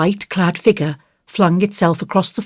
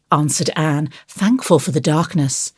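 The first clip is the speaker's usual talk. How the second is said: usual speaking voice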